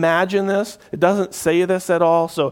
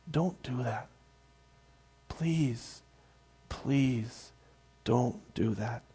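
much softer and lower, pleading gently; compassionate plea; 5 semitones lower, 12 dB quieter, much slower than usual. Retold or imitated. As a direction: beg them tenderly to stop. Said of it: "I hear more compassion in his voice".

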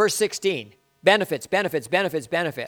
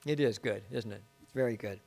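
hushed aside; 7 semitones lower, 11 dB quieter than usual. Real, not acted. Quiet aside after scripture.

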